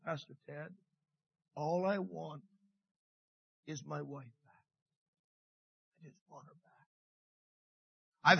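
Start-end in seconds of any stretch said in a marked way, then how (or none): 0.0-6.9 soft, low, choked husband's plea; quiet grieving husband begging for his wife; broken-hearted plea. Retold or imitated. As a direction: barely get the words out, broken. Weeping husband quoted quietly.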